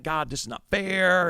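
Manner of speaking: mock whining. Passenger complaining to God about his seat, mock whine. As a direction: whine it like a hard-done-by victim. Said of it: "I'm just whining".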